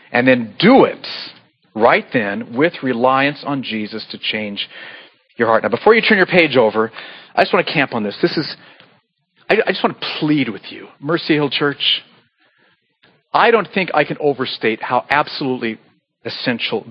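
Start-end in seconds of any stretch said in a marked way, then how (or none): none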